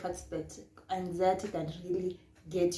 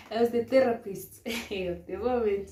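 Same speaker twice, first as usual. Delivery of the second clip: laughing; 4 semitones higher, 8 dB louder than usual. Real, not acted. Laughing at own story.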